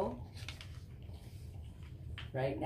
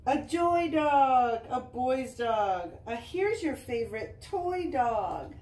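higher, louder, slow joyful reading; reading to children; 6 semitones higher, 7 dB louder, slower than usual. Acted. Mom reading the happy reunion to Easton, joyful read-aloud. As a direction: let the happy ending shine.

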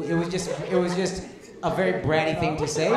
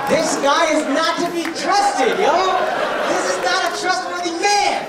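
higher and louder, hammed-up alarm; mock public warning; 8 semitones higher, 3 dB louder than usual. Comic warns audience women about this man, loud mock alarm. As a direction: warn the whole room like a town crier, half joking.

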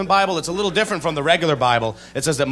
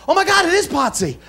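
excited mom; 11 semitones higher than usual. Retold or imitated. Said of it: "she gets all excited".

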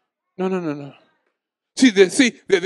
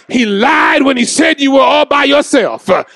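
shouted, higher sermon climax; full-force preaching; 13 semitones higher, 5 dB louder than usual. Acted. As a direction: preach it loud to the congregation.

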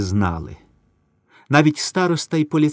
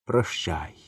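soft, low goodbye; quiet farewell; 5 semitones lower, 8 dB quieter than usual. A narrator's reading. Airman says goodbye to his friend, soft and low. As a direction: say goodbye barely above a breath.

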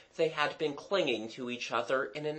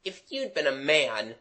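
mildly withering schoolboy scorn. A narrator's reading. Jimmy scorning Mabel's defence, withering. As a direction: put them down with a touch of scorn. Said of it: "said Jimmy witheringly".

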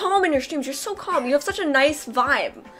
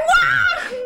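laughing high mock wail; high wailing shout. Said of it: "I'm like, I'm like".

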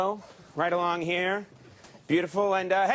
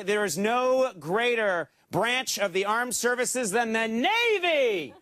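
slow, swelling announcer build to "the Navy"; 2 semitones higher, slower than usual. Shown as a demonstration. Host setting up the applause button for the sailors, slow grand build. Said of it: "hey, try this".

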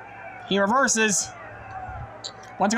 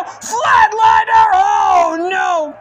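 loud drawn-out shout; 8 semitones higher, 7 dB louder, much slower than usual. Acted. Calling the big move.

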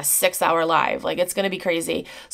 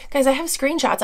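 ordinary talk, nothing marked

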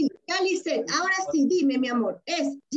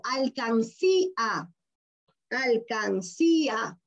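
"Alcancía" drawn out slowly, syllable by syllable; 3 semitones lower, much slower than usual. Shown as a demonstration. Teacher gives pupil a word to divide, "Alcancía" slowly syllabified. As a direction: stretch "Alcancía" into separate syllables, falling at the end. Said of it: "la regla del silabeo".

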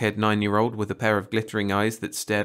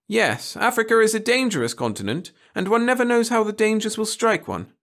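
complacent; 13 semitones higher, 5 dB louder than usual. Acted. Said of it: "said Miss Burton complacently".

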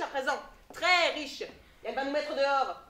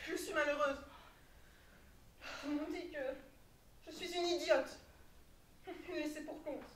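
self-pitying; 6 semitones lower, 12 dB quieter than usual. Acted. Self-pitying lament after quarrel.

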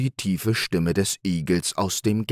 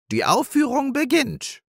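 much higher, ringing call to the audience; proud announcement. Acted. Hare opening the play for all the meadow, calling out. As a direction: announce the show grandly. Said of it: "rief Hase Nepomuk".

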